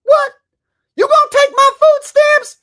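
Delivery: very high, loud indignant squawk; hammed-up sassy outrage; mock sassy. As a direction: screech it in a mocking put-on voice. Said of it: "In a very sassy manner".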